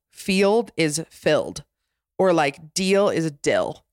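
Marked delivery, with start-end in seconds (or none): 0.1-1.6 Utah accent, "field" said like "filled" with a short eh; slow Utah-accent demo of field. Shown as a demonstration. Explaining the Utah accent to listeners, slow Utah accent demo. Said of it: "any E noise is usually eh". 2.7-3.8 Utah accent, "deal" said like "dill" with a short vowel. Shown as a demonstration. Explaining the Utah accent to listeners, slow Utah accent demo. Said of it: "any E noise is usually eh".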